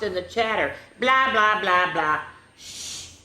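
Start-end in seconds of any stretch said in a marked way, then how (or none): 1.0-2.2 mock chatter. Mimicking classroom chatter. 2.6-3.2 sharp unvoiced shush. Teacher hushing chattering kids, a sharp shush.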